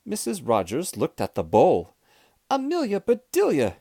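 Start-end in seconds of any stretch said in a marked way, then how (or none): 2.5-3.8 high-pitched exclamation of dismay; exasperated, high-pitched cry. A narrator's reading. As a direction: cry the name out in alarm. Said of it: "she cried".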